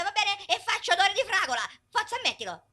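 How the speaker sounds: ordinary delivery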